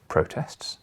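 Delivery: usual speaking voice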